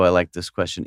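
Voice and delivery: usual speaking voice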